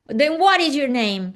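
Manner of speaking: put-on a fed-up lady snapping back at the child; aggravated retort. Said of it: "She's all aggravated".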